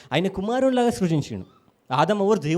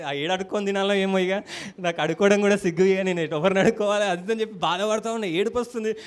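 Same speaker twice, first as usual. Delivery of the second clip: ordinary talk, nothing marked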